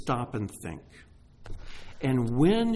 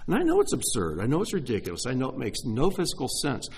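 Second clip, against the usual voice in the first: ordinary delivery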